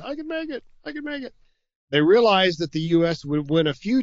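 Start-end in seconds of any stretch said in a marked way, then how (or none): 0.0-1.3 squeaky strained voice, much higher and softer; voice cracking high. Pretend. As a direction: let the voice crack up into a thin squeak. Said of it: "my voice is starting to give out".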